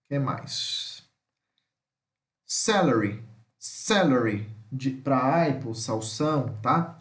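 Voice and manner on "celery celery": "Celery" stressed on the first syllable, pitch dropping away; teacher's word drill on "Celery"; pronunciation demo. Shown as a demonstration. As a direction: model "Celery" carefully, pitch falling. Teacher models English word repeatedly.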